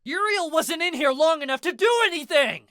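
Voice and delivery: high, loud furious scream. A narrator's reading. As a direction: scream it at Mad Dog. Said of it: "I screamed".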